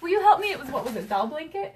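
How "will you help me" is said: high, loud, laughing imitation; retold plea for help. Friend retelling a sewing request, mock plea. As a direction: mimic an eager request through a laugh. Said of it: "was like".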